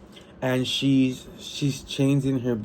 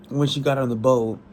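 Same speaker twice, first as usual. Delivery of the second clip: ordinary talk, nothing marked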